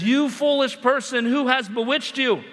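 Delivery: a sharp rebuke read out from an email; harsh accusing email. Pretend. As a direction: fire the accusation straight at them. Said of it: "in the subject line, it says".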